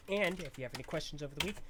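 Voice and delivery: normal talking voice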